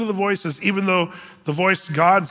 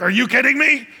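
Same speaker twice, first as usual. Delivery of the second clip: higher and louder, mock incredulous; mock disbelief; 6 semitones higher, 4 dB louder than usual. Pastor pretending to scold a congregant, mock disbelief. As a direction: play the shocked devil's advocate. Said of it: "do the devil's advocate thing and say".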